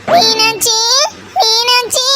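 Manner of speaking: high, drawn-out tearful call. Acted. Mother arriving in tears calls her daughter, tearful calling. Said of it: "ஏம்மா அழுதுட்டு வர்றீங்க".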